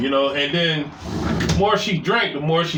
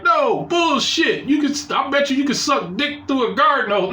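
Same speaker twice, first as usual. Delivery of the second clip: drill sergeant; 7 semitones higher than usual. Retold or imitated. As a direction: bark it like a boot camp sergeant. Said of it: "And he was like".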